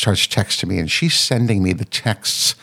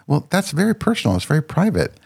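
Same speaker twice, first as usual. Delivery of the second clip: much higher, prim and offended; indignant daughter; 9 semitones higher than usual. Retold or imitated. Daughter scolding her about a private story, prim and high. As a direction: play the young woman scolding her, primly.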